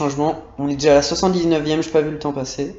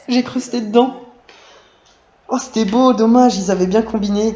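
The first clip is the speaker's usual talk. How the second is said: excited; 6 semitones higher than usual. Real, not acted. Reacting to near miss.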